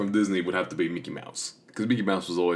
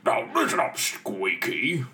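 higher, bossy cartoon bully; Pete voice; 6 semitones higher, slower than usual. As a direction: order him around like a big cartoon bully. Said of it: "if you remember Pete".

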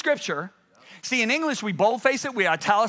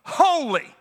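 loud, higher proclamation of one word; crying out; 7 semitones higher, 4 dB louder than usual. Acted. One seraph crying to the other, crying out. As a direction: cry it out, then let it fall. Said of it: "one is crying to the other".

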